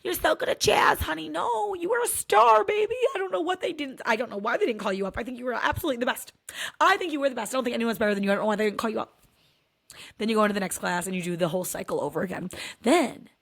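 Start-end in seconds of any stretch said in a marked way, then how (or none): 0.0-9.1 high, sweet reassuring mom voice. Retold or imitated. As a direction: comfort her in a sugary high voice. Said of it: "I'm like".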